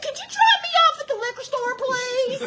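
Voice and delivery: high, hammed-up polite plea; sweet request. Asking the driver for liquor store, pleading. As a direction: ask loudly in a high, pleading voice.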